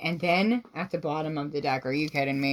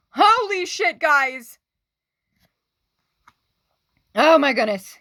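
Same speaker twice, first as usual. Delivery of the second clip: high, loud exclamation; startled outburst; shocked excitement; 10 semitones higher, 6 dB louder than usual. Real, not acted. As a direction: blown away by the card. Shocked by sustainer card.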